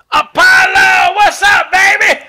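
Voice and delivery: high, loud shrieked greeting across the room; squealing hooker. Retold or imitated. Excited hooker calls out to radio host. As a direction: hooker shrieks his name from the doorway. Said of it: "and the girl goes".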